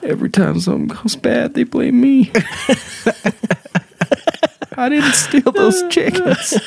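high, laughing whine of a wronged creature; whiny misunderstood monster. Pretend. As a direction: plead your innocence, giggling. Voicing the misunderstood Jersey Devil.

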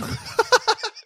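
squeaky high-pitched laugh; laughing at the rap joke; high giggle. Real, not acted. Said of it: "M C Paulie Kazoo".

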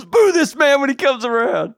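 high heckling crowd voice; mock crowd heckle. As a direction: heckle from the stands.

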